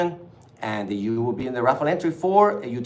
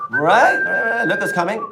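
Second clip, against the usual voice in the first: excited; 8 semitones higher, 4 dB louder than usual. Real, not acted. Hyping the clothing line.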